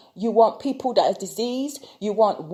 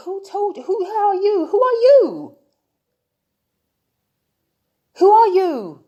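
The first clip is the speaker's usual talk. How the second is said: high, loud indignant cry of who are you; outraged demand; 9 semitones higher, 6 dB louder than usual. Real, not acted. Vlogger challenging online critics, outraged high shout. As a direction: demand it at the top of your voice.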